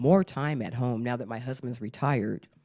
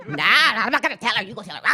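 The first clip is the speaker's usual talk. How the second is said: laughing spirit; 5 semitones higher, 4 dB louder, much faster than usual. Pretend. Spirits arguing.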